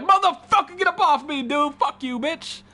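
high, loud fake rage at an attacker; mock furious yell. Streamer acting out fighting back when attacked, high loud mock yell. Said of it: "No, I'm like".

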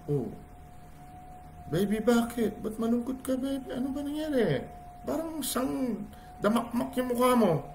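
put-on high, soft coaxing baby talk; soothing daddy. Father gently asking his sad daughter, high and soft. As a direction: coax a sad little girl.

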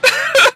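high-pitched burst of laughter. Real, not acted. Recapper after mocking Miss Winterbottom, amused high laugh. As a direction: crack up at your own joke. Laughs at her own joke.

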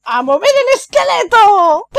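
big booming coach shout, laughing; loud shout. Acted. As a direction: yell it like a hyped coach. Undyne voice shouting.

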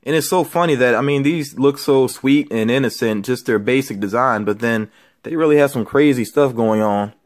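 normal talking voice

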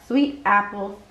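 plain reading voice

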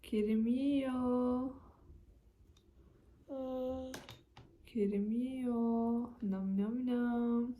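put-on slow singsong baby talk. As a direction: coax him gently, drawn out. Mother feeding toddler, slow singsong. (bebeğe konuşur gibi, yavaş ve şarkımsı)

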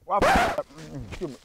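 talking while chewing food; speaking after a bite; mouth full, chewing. Real, not acted. Seen 1: Profile lip motion at 0.1-0.7, napkin at mouth at 1.0.

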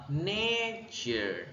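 "nature" as NAY-ture, NAY drawn out; first-syllable stress. Shown as a demonstration. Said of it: "Nhấn âm, âm một". (nhấn âm một của "nature")

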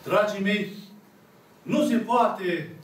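normal talking voice

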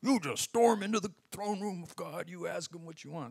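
mocking imitation of a pushy preacher; hammed-up bad teaching, dropping away at the end. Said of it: "you hear bad teaching sometimes".

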